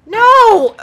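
sharp yell of frustration; real frustrated yell; loud no. Real, not acted. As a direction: shout it as you fall. Fails jump in rage game.

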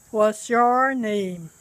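slow, deliberate "What's your name?"; each word laid out slowly; slow model question. Shown as a demonstration. Teacher modelling the question slowly for the students.

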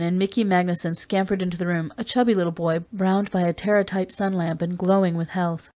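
ordinary delivery

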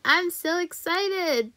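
high, drawn-out excitement; excited. Real, not acted. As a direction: stretch it out with rising delight, high and slow.

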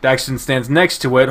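normal talking voice

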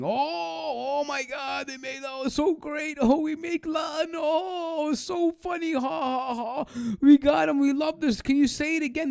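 high, giddy mocking voice with fake laughter; mock gloating. Mocking the audience's gloating over the curses, high and giddy. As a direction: ham up their giddy gloating. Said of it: "engaging in this gloating".

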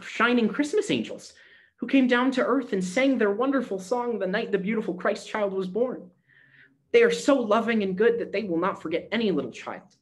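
put-on high, eager child's voice; full of wonder and faith; bright little girl. A narrator's reading. Gretchen brightly tells Granny about the angels, high and eager.

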